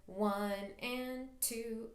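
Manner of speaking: very slow, steady count; "One and two" drawn out evenly. Shown as a demonstration. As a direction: count each beat slowly in time. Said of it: "keep that in your head".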